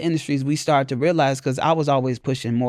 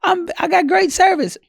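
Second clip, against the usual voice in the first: higher, louder salesy voice; high mock sales pitch; 4 dB louder than usual. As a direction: parrot a bland pitch. Imitating a generic provider's pitch.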